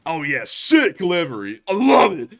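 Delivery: loud, rising burst of enthusiasm; excited agreement. Real, not acted. Streamer excitedly agreeing with co-host about livery. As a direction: hype up the jet's paint job.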